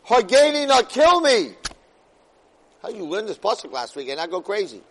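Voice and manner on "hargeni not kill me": high, loud desperate cry; desperate plea. Acted. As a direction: beg for it at full voice. Moshe begging for death.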